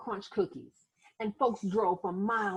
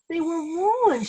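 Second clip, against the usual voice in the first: cried; 8 semitones higher, 7 dB louder, slower than usual. A narrator's reading. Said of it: "she cried".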